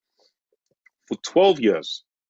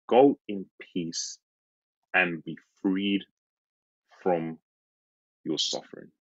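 slow, fading to a whisper; tender blessing; 4 semitones lower, 3 dB quieter than usual. Acted. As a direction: let it sink into a hushed benediction. Jesus's blessing, softly repeated.

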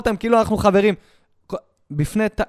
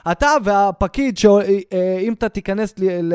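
normal talking voice

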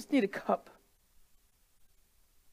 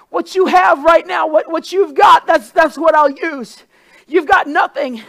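full-force preaching; 9 dB louder than usual. Acted. Preacher voicing God's answer to the widow. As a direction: drive it home, shouting.

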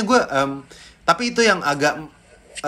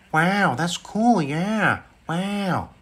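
higher, airy drawn-out amazement; Owen Wilson; 5 semitones higher, much slower than usual. Shown as a demonstration. Impressionist as amazed film star, Owen Wilson drawl. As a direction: stretch each wow in mild, lazy wonder. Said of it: "Owen Wilson".

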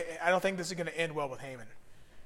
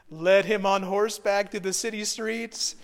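normal talking voice

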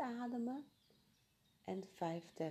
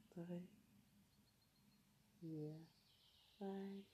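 slow counting, much softer; 13 dB quieter, much slower than usual. Acted. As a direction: count gently, almost whispering. Counting breaths softly.

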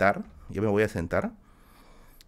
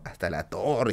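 ordinary talk

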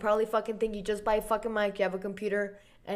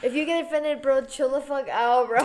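frat bro; 5 semitones higher, 5 dB louder than usual. Acted. Mock-aggressive frat bro rant.

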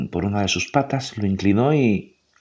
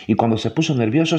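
ordinary delivery, nothing marked

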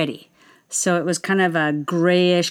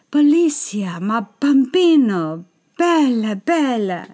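put-on Italian accent; 4 semitones higher than usual. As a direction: croon it like a charmed Italian tenor. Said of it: "[name] stopped, took my hand and said".

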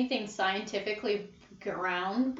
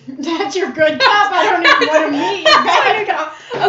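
loud laughing reply; 6 semitones higher, 12 dB louder than usual. Real, not acted. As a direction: laugh through the words. Laughs at cop voice.

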